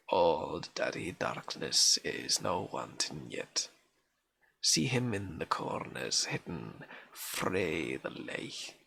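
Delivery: reading a Scots lullaby poem in a broad Scots accent. Shown as a demonstration. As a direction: roll the Scots words gently, like a granny's old song. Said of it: "forces you to read in an accent".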